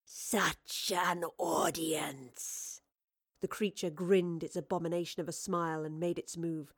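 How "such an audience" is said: very slow, low-key monster voice; wraith's gloating. A narrator's reading. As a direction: draw it out as a menacing wraith.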